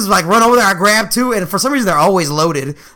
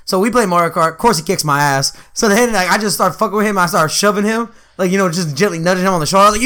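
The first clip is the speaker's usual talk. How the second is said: normal talking voice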